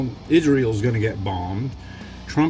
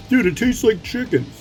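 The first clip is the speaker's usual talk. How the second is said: higher, pushy know-it-all bro; insistent dude voice; 11 semitones higher than usual. Retold or imitated. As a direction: mimic someone swearing it's chicken. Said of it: "swear up and down and get even mad".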